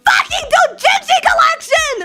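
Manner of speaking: mock-tragic cry over lost comics; anguished wail. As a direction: wail it like a tragedy, half laughing. Comedic anguish over destroyed porn.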